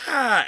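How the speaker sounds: high loud cry from below; mock scream from the pit. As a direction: let out a high loud yell. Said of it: "you hear him scream".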